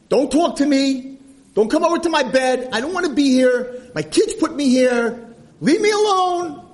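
high, loud, cranky yelling; furious hammed-up old man's outburst; angry old man. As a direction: holler like a miserable old grouch, dragging out the ends. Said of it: "was a miserable, angry old man".

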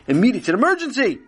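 rising panic; urgent. Acted. Parent urgent.

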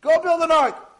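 high, loud barked command; commanding order. Acted. God ordering Noah to build, commanding. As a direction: issue the order at full voice.

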